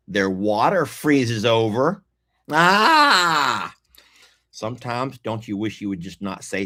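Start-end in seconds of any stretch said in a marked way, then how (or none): 2.5-3.7 sharp high yelp of frustration; loud exasperated yelp; sudden cry. Real, not acted. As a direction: let out a quick high cry. Sudden cry mid-rant.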